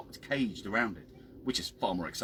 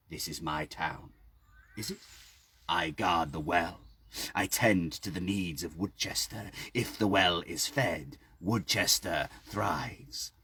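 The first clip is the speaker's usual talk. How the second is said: put-on slow low old man; 5 semitones lower, 3 dB quieter, slower than usual. A narrator's reading. Old man claims the town.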